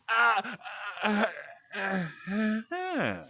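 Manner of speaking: high helpless ah-ah-ah; speechless stammer. Retold or imitated. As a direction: stammer with no words, rising. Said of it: "I was like".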